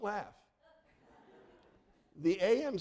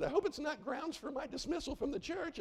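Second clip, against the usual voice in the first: high lilting imitation of a churchwoman, rising at the end; high-voiced mock worry with an upturned ending; winsome, upbeat; 11 semitones higher than usual. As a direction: pitch it way up, sweetly anxious to please. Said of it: "she was sounding winsome and upbeat".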